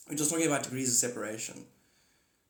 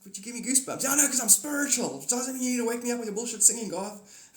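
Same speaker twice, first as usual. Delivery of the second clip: high, loud, fast rant; hammed-up exasperated scolding; grumpy Holy Spirit; 8 semitones higher, 6 dB louder, faster than usual. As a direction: berate someone for waking you. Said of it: "who's not a morning person".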